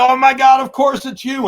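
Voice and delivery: loud, high-pitched burst of realization; freaked-out excited outcry; freaking out. Retold or imitated. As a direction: shout it high, as if it suddenly clicks. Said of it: "they're, like, freaking out".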